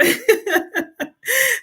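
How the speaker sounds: a long high-pitched giggle. Real, not acted. Laughing at own story.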